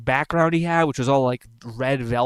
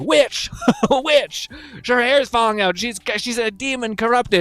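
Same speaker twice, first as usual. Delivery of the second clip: much higher, louder mock cry of witch; accusing villagers; 8 semitones higher, 4 dB louder, slower than usual. Old-time villagers accusing a sick woman, higher and louder mock cry. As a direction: shriek the accusation like a mob. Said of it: "people were like".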